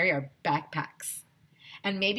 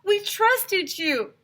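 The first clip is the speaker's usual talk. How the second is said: much higher, slow, wounded cry; hurt parent; 13 semitones higher, 5 dB louder, much slower than usual. A narrator's reading. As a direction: drag it out, betrayed.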